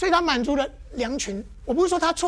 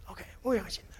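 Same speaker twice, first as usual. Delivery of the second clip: whispered aside; 6 semitones lower, 10 dB quieter than usual. Real, not acted.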